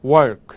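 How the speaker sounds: "work" with the lips pushed forward as if for a kiss; lips rounded. Shown as a demonstration. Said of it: "입을 동그랗게 모아서 마치 뽀뽀하듯이".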